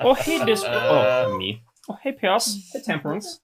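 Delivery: high, bright greeting voice; high character voice. Acted. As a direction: greet everyone in a sing-song.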